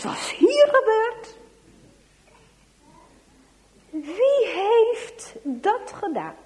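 loud, high-pitched stern demand; acted schoolmaster's angry interrogation; outraged teacher. Teacher confronts class after the prank.

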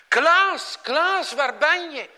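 loud, high searching call; shout, looking for the lost boy. A narrator's reading. Skipper searches the sea for Klaas, shouting. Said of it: "Baas de Goede roept het".

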